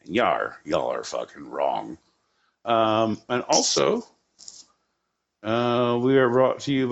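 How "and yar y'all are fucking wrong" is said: low growled pirate voice; lightly done pirate accent. Pretend. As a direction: talk like a pirate. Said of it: "it's in a pirate accent".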